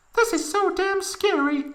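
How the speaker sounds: high falsetto mock laugh; a squeaky fake-laughing girl; laughing Tifa. A narrator's reading. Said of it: "laughed Tifa".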